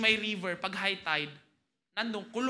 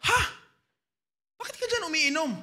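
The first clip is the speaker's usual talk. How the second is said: surprised question; 6 semitones higher than usual. Retold or imitated. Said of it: "sabi niya".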